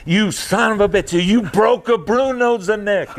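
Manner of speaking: put-on high, slow, angry old man with an Italian accent. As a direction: curse him out in a thick Italian accent. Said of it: "in this Italian accent".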